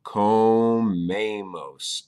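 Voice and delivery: comemos said very slowly, each syllable clear, stress on me; slow model word. Shown as a demonstration. Teacher slowly modeling comemos for beginners. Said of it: "You would say".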